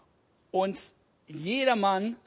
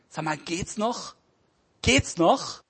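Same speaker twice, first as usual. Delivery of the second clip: angry retort; 5 dB louder than usual. Acted. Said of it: "schnauzt den Vater".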